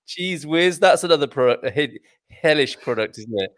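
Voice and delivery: ordinary talk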